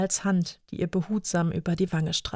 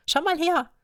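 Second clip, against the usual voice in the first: put-on excited little girl; 11 semitones higher than usual. Lea excited with mirror.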